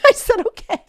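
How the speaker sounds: high laughing burst; giggling through it. Real, not acted. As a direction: laugh at your own innocence. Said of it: "laughs at own naivety".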